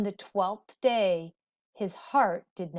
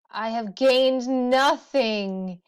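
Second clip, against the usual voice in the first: slow, louder raised lament; 4 semitones higher, 6 dB louder, slower than usual. A narrator's reading. Gilgamesh lamenting the snake's theft, slow and raised. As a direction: mourn the stolen plant, slowly. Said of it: "he lamented".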